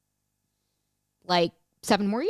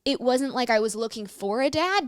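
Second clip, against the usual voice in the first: ordinary talk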